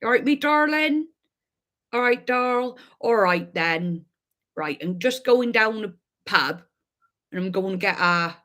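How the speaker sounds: lightly done Devon accent, rolling and homely; a Devon local off down the pub, in Devon accent; playful Devon accent; classic Devon accent. Shown as a demonstration. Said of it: "if I was to do a classic Devon accent".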